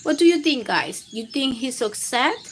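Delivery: ordinary delivery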